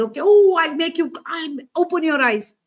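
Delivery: high, loud, hammed-up offer; mock eagerness. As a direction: play an overeager healer, mockingly. Mock version of Jesus rushing to heal.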